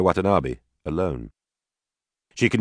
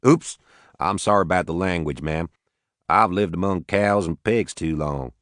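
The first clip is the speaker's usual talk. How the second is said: lightly voiced Southern farmer; southern accent. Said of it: "He had a heavy southern accent".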